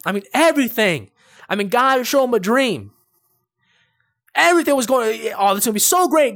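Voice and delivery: high, loud, fervent preaching; preacher builds up about Joseph's dream; preaching at full force. Acted. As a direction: drive the point home to the church.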